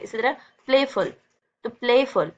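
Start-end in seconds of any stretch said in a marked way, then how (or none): 0.7-1.1 "playful" with play held and stressed; stress on first syllable. Shown as a demonstration. Teacher showing syllable stress, stress on play in "playful". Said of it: "play पे आप रुकते हो". 1.7-2.3 "playful" with a loud, held play; stress on first syllable. Shown as a demonstration. Teacher demonstrating stress to pupils, play punched in "playful". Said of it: "play पे आप रुकते हो थोड़ी देर".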